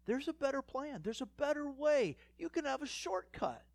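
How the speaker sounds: high, coaxing tempter; tempting voice. Retold or imitated. Preacher voicing temptation to the congregation, high and coaxing. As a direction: coax them high and sweet toward the shortcut.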